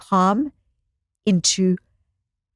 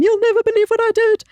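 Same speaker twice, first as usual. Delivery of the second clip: much higher, louder, dramatic confession; dramatic exclaim; 13 semitones higher, 4 dB louder, faster than usual. Retold or imitated. Wife telling husband about her day, dramatic high exclaim. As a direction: burst out like you can't believe yourself.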